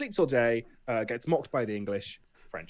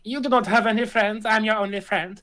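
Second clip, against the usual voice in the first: cat speaking higher and louder; a clingy pet, pitched up and loud; mock cat voice, higher, louder; 9 semitones higher, 7 dB louder than usual.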